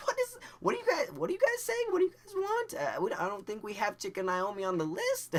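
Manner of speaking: high-pitched, loud mock cashier voice; put-on high voice of a baffled worker; confused cashier. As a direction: squeal it in bewilderment at the customers.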